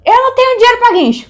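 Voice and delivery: high, loud desperate yell; high yelled plea, half-panicked; retold shout. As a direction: shout over the rain to the cop. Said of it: "eu gritei pro cara".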